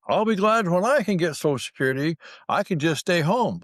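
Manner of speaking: mimicking neighbor. Neighbor wishing for Social Security.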